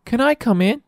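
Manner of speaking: put-on very high child voice; high-pitched young heroine; eager little girl. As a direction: chirp it as a keen young girl. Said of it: "says Nellie".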